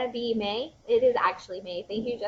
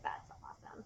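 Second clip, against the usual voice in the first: whispered; 22 dB quieter than usual. Retold or imitated. Retelling her quiet reaction.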